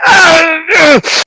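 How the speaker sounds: high, drawn-out cry of pain. Acted. Gnome's hand burned by lightning, high pained cry. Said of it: "he kind of cries out for a moment".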